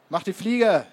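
loud, high shout to clear off; very loud call. A narrator's reading. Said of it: "rief eine sehr laute Stimme". (sehr lauter, scharfer Zuruf)

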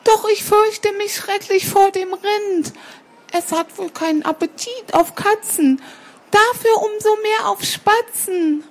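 very high little bird voice; timid little sparrow. Acted. Sparrow child tells cat of fearing the cow, high and timid. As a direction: confess the worry in a high, childlike voice.

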